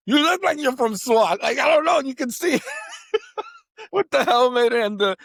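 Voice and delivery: excited, high voice breaking into laughter; high, giggly outburst; laughing. Real, not acted. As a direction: blurt it out high and tickled, laughing. Excited joking about SWAT, laughing.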